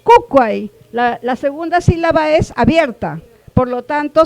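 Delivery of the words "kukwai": Kukwai said louder, stressing the first syllable; stressed demo. Shown as a demonstration. Teacher modelling Kukwai's stress for the class, loud. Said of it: "se acentúa la primera".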